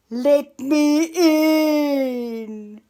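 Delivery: very slow, loud drawn-out wolf demand; menacing wolf. Acted. Wolf demanding entry at the brick house, slow and loud. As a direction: stretch it out like a hungry wolf.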